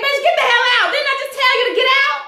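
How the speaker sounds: high, fast mock yell telling her to get out; mock angry yell. Imagining telling Esperanza to get out, mock yell. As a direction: snap her out of the room in a high squawk. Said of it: "I was like".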